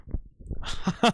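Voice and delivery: short run of ha-ha laughter; amused laugh. Real, not acted. Streamer caught out on a word, amused laugh. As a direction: laugh briefly at your own mistake.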